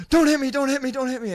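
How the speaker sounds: very high, rapid begging; high-pitched frightened squeal of words; mock panicked pleading. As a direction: imitate a cowering man's frantic plea. Imitating man begging not hit.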